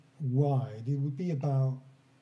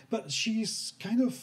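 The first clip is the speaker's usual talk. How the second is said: talking through laughter, pitched up; giggling while explaining; laughing; 6 semitones higher, 4 dB louder than usual. Real, not acted. GM laughing at the players' joke.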